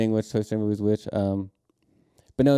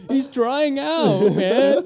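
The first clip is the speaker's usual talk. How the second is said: laughing; 6 dB louder, much slower than usual. Pretend. Mock-sad pity, laughing.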